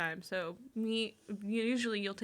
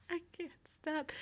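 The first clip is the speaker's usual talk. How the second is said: mock helpless plea; 7 semitones higher, 6 dB quieter than usual. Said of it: "you're like".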